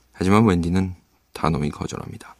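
ordinary delivery

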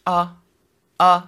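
"아" short and light, unstressed; Mandarin neutral tone. Shown as a demonstration. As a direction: toss off "아" lightly. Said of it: "경성은 엄살 피워".

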